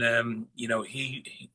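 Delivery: normal talking voice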